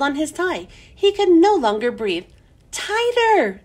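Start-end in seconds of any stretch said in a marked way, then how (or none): 2.7-3.6 high, loud group yell; egging him on with a high yell. A narrator's reading. Class urging Stephen to pull tighter, loud yell. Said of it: "everyone yelled".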